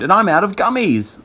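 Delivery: high, dismayed whine; moaning. A narrator's reading. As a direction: wail that rescue has run out. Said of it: "Greg moaned".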